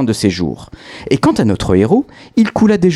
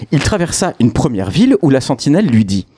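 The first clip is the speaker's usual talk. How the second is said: ordinary delivery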